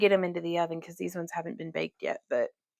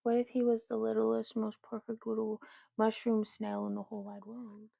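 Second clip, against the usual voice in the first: softly doting; tender gushing; 10 dB quieter than usual. Real, not acted. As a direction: gush softly about it.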